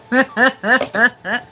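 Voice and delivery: high, loud laugh; real laugh. Real, not acted. Laughing while greeting viewers on the stream. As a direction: laugh warmly to the chat.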